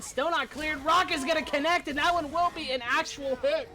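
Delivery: loud, high esports casting; excited play-by-play. Real, not acted. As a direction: call the hit as it happens. Live play-by-play of game.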